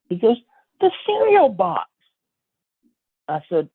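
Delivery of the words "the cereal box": very high, excited friend imitation; awed excitement. Friend showing off cereal find, acted awe. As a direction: pitch it way up as if it's treasure.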